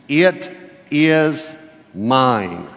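slow, weighty it is mine; emphatic it is mine. Shown as a demonstration. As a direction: spell it out slowly, word by word, as God's claim. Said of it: "It's a very emphatic phrase".

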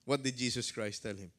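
normal talking voice

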